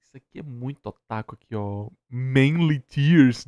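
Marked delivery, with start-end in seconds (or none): 2.1-3.5 Manly Tears stretched very slow and loud; drawn-out title. Acted. Streamer mocking an otaku term to chat, slow and loud. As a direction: drag out Manly Tears like a mock announcement.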